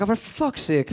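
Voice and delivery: ordinary talk, nothing marked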